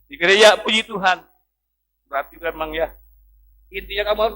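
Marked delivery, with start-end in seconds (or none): 0.1-1.2 retold preacher's urging, louder; emphatic exhortation. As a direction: press the point home. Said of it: "Saya katakan".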